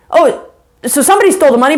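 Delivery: ordinary delivery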